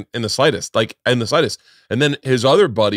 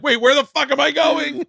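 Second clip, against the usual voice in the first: much higher, startled bewilderment; suddenly lost, much higher; bewildered. Retold or imitated. Driver leaving Boca, much higher and startled. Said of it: "You're like".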